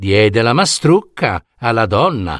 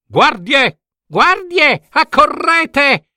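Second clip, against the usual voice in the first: shouted order; slower than usual. Acted. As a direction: summon the guards in alarm. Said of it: "donna Vittorina ordinò".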